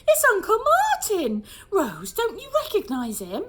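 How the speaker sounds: high, loud, half laughing; delighted it's him; excited recognition. A narrator's reading. Mum urging Rose to recognise her uncle, high and laughing. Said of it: "Susie gasped".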